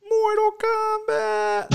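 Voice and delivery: very high, drawn-out Mortal Kombat cry; playful high-pitched title call; hammed-up title cry. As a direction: stretch it out way up high like a game theme shout. Hammed-up echo of game title.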